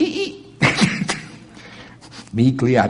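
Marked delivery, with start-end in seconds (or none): 0.0-0.6 high squeaky squeal of brakes. Retold or imitated. Hitting the brakes, mimicked squealing tires. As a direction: mimic the car screeching to a stop. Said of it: "the car went".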